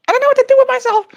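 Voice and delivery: very high, squeaky frazzled bird; agitated bird voice. Retold or imitated. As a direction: squeak it out like a flustered parrot. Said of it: "kind of thing".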